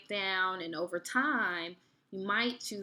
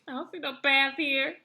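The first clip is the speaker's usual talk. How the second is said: laughing through the words; laughing disbelief; 5 semitones higher, 6 dB louder than usual. Retold or imitated. To her boyfriend in the woods, laughing disbelief. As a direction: chuckle while doubting there's a path.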